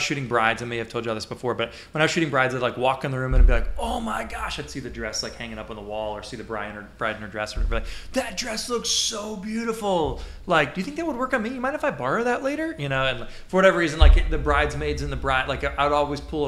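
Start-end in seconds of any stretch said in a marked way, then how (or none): 3.8-4.6 high, gushing oh my gosh; mock gushing gasp. Gushing over bride's dress. 8.1-12.7 high, excited, teasing gush; gushing girly voice. Retold or imitated. Joke to bride, gushing voice.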